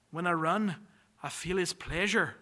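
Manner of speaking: put-on a weak Scottish accent, only lightly there; quoting Eric Liddell in a faint Scottish accent. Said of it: "my Scottish accent has not improved".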